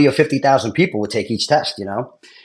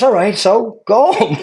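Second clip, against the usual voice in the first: high, slow, drawn-out brush-off; mock dismissal; 10 semitones higher, 4 dB louder, slower than usual. Investigator brushing off a worried applicant, comic dismissal. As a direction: imitate an unbothered investigator, stretching the words.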